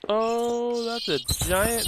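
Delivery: loud, high startled oh, then slow. Real, not acted. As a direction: caught off guard by something big. Startled at a creature.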